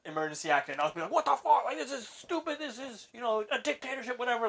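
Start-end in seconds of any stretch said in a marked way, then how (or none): 1.1-4.5 high mocking voice, sneering at the fuss; mock whiny complainer. Imitating a complaining guy, high and mocking. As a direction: mimic a petty complainer, pitched up.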